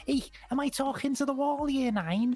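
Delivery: high, nagging Scouse imitation; Scouse form tutor. Form tutor to her school class, imitated Scouse. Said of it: "my form tutor was a Scouse".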